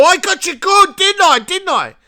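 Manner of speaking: very high, loud gleeful taunt; high loud mock gloat, "didn't I?"; gloating troll. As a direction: crow it like an online troll who scored. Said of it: "Makes them feel powerful".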